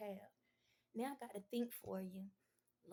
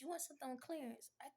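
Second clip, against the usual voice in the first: ordinary talk, nothing marked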